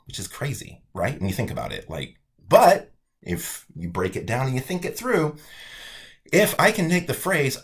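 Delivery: usual speaking voice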